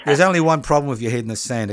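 quick, rushed delivery; fast. Real, not acted. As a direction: rattle it off. Mock wisdom about the caller.

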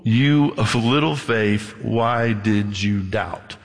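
slow, heavy voice of Jesus letting Peter down; Jesus rebuking. Retold or imitated. Jesus gently scolding a sinking Peter, slow and heavy. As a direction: drag it out, disappointed. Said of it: "now this is brutal".

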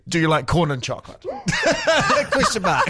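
"corn" said through rising laughter, then a high burst of giggles; laughing through it. Real, not acted. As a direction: read it and crack up helplessly. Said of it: "I'm gonna say corn again".

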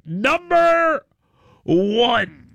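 slow, high, drawn-out countdown call; announcer countdown. Acted. Host announcing story number three, drawn-out announcer call. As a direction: announce the next item like a show host.

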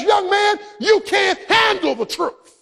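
a hint of Nicholson's courtroom sneer; Jack Nicholson line. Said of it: "looks back as only he can and says".

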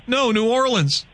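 lightly voiced stranger, pitched high; imitated correcting reply. Host retells the man naming his hometown, high imitated reply.

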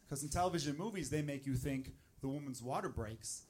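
ordinary talk

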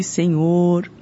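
ordinary delivery

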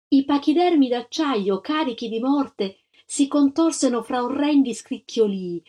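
usual speaking voice

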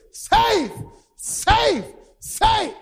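high, punchy calls, each falling off; cheering team. Acted. Home team runs out of the dugout, cheering calls. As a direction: call it out like players cheering a safe slide. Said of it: "The home team came out of the dugout cheering".

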